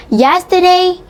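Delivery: usual speaking voice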